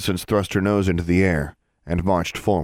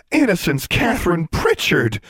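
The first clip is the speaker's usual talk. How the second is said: shocked exclamation; 11 semitones higher, 4 dB louder than usual. A narrator's reading. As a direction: blurt the name out in disbelief. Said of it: "they both exclaimed together".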